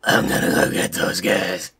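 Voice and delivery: slow, voiceless growly whisper; mock action-hero whisper; whispery movie-star voice. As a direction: rasp it out slowly under the breath. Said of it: "him talking like this".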